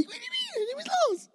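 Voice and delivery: put-on very high, squeaky little-kid voice. Pastor mimics his squeaky child self losing. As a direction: squeak it out like a small boy. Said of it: "Little squeaky [name]".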